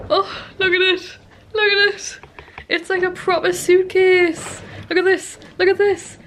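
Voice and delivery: high-pitched, overcome with delight; real emotional excitement. Real, not acted. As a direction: marvel at it, voice lifted high with emotion. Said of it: "I am actually emotional".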